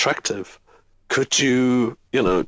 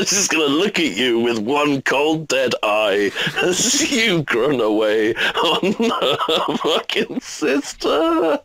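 laughing; 5 semitones higher, slower than usual. Real, not acted. Lewd twin story, laughing.

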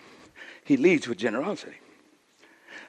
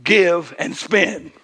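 preacher correcting the church, slow stress on give and spend; slow stressed wrong version; 4 semitones higher, 5 dB louder, much slower than usual. Shown as a demonstration. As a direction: give and spend, drawn out slowly, weight on give. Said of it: "I didn't say".

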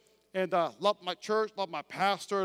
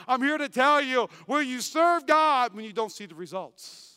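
normal talking voice

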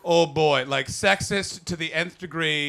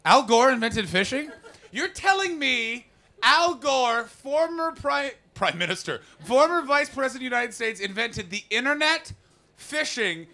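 higher, louder mock disbelief; incredulous; 5 semitones higher, 4 dB louder than usual. Real, not acted. Comic reacting to audience member, higher and incredulous. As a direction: ham up the outrage.

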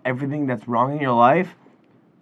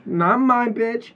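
very slow, high, stretched words; drawn-out mock sermon; 9 semitones higher, much slower than usual. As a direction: stretch each phrase like a mock preacher.

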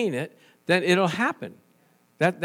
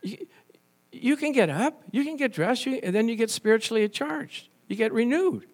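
incredulous pastor; 7 semitones higher than usual. Retold or imitated. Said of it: "I go".

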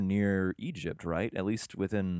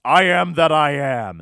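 booming, slow and much louder; capitalized words; 7 semitones higher, 13 dB louder, slower than usual. Acted. God naming himself to Moses, booming and slow. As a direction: proclaim every word big, like capital letters. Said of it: "and this is capitalized".

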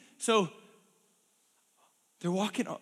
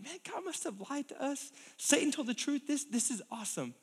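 much softer, musing; imagined thought; 4 semitones higher, 7 dB quieter than usual. Acted. Adam and Eve musing to themselves, soft and pleased. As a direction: think it half aloud.

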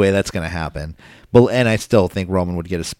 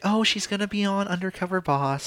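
lightly mimicking mom, pitched up; high mom voice; 11 semitones higher, 8 dB quieter than usual. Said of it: "She's like".

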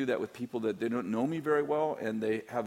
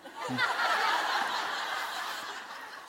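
high breathy laugh. Real, not acted. After teasing story.

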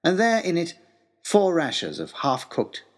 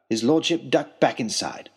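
plain reading voice

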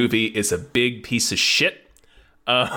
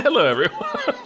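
higher playful hello; silly high greeting; 9 semitones higher than usual. Pretend. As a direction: greet in a goofy raised voice.

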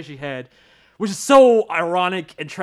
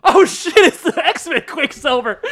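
surprised; 9 semitones higher, 9 dB louder, slower than usual. Retold or imitated. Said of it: "I'm like".

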